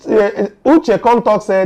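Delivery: ordinary talk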